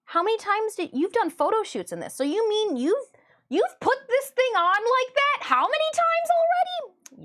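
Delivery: a viewer who can't believe it, building to a shriek; mock disbelief. Imagined viewer reacting to the cosplay, building mock disbelief. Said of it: "And you will be like".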